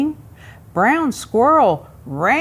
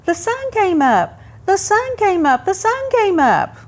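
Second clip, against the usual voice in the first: high, bright little squirrel voice; chirpy squirrel; 11 semitones higher than usual. Acted. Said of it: "he chirped".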